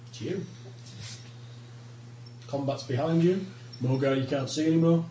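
ordinary delivery

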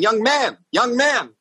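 high-pitched imitation of a lady calling out; high, demanding mock heckle; old lady heckler. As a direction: mimic an insistent elderly audience member.

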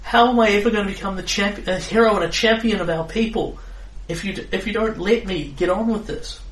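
put-on young centaur girl, high and pleading; proud voice with horse snorts. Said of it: "the younger centaur's voice".

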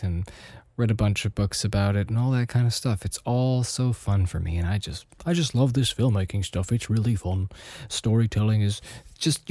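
ordinary talk

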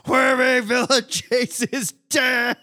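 high, drawn-out mock scream breaking into laughter; retold screaming. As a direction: holler it slowly, tickled by the absurdity.

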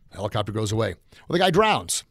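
ordinary talk

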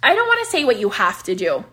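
ordinary delivery

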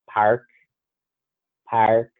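"park" with a strong, pronounced R. Shown as a demonstration. Showing how Boston would say it, "park" with strong R. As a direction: lean hard on the R in "park". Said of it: "strong R's".

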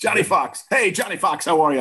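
imitated hearty greeting, pitched high; Jerry Jones. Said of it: "How do you think he addressed me?".

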